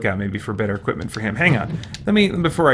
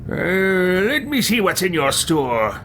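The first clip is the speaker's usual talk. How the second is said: higher, slow put-on voice; player character voice; 6 semitones higher, slower than usual. Adventurer asking the shopkeeper to show goods, drawn-out role voice. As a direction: stretch it out as the game hero.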